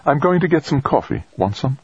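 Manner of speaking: female character voice. A narrator's reading. Trudy voiced in narration.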